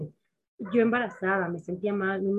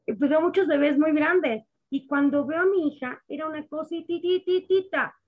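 moved amazement; 5 semitones higher than usual. Retold or imitated. A mother telling of first seeing her baby, higher awed voice. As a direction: marvel at the tiny baby. Sees her tiny premature daughter.

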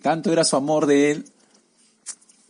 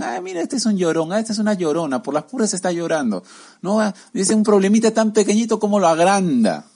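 higher, scoffing voice brushing off someone's pain; a higher, sneering put-down of a crybaby; mocking dismissive deceiver; 7 semitones higher than usual. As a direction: sneer like a liar who downplays everything. Deceiver mocks the wronged.

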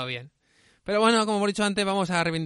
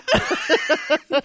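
loud laugh; 10 semitones higher, 8 dB louder than usual. Real, not acted. Laughing hard while retelling a film scene to his cohost. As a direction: burst of high laughter.

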